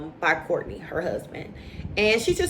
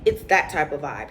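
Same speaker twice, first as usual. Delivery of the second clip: normal talking voice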